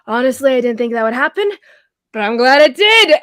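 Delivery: loud and cheerful, booming out; jolly loud dwarf; dwarf's hearty shout. Acted. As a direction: bellow it happily to the crowd. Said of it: "and he's like".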